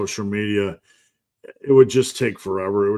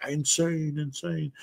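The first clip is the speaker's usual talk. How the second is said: fading echo, higher and softer; 6 semitones higher, 7 dB quieter, slower than usual. Retold or imitated. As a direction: let the word echo away. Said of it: "with echoing".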